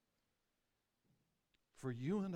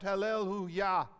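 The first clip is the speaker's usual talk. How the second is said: raised cry of praise, moderately loud; 3 semitones higher, 4 dB louder than usual. Shown as a demonstration. Preacher urges us to cry out to the King, raised cry. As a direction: lift the voice, stressing the final syllable. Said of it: "we should cry out".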